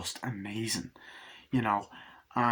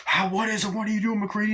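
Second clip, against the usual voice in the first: high, mock-panicked challenge; acted alarm; 11 semitones higher, 4 dB louder, faster than usual. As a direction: act out crying out at a crewmate.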